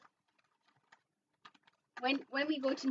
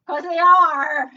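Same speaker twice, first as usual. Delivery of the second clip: loud, drawn-out pirate "arr" on "are"; hammed-up pirate roar; 5 semitones higher, 14 dB louder, slower than usual. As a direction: bellow the punchline, stretching "are" like a pirate. Pirate joke punchline, "arr.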